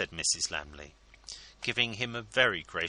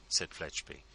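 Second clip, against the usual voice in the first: ordinary delivery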